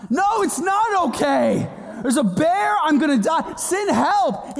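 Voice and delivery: high, frantic holler back; panicked yelling. Retold or imitated. Scared son arguing with mom about a bear, panicked yell.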